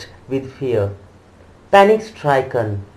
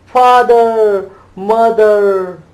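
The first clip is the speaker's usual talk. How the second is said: high, loud desperate shriek; shrieking call; 6 semitones higher, 6 dB louder, much slower than usual. A narrator's reading. Child trampled in the crowd shrieks for his parents, desperate shriek. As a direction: scream for them with all your voice. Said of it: "shrieked at the highest pitch of his voice".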